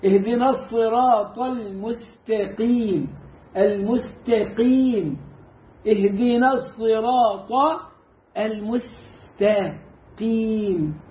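slow, drawn-out recitation with heavy ص and light س; correct Quran recitation. Shown as a demonstration. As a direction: recite اهدنا الصراط المستقيم slowly, emphatic Ṣ, light S. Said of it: "وأقل درجات التفخيم". (تلاوة بطيئة مجودة)

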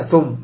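wrong stop, no hum on meem; short meem in ثم. Shown as a demonstration. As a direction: clip ثم without any ghunnah. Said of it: "خطأ".

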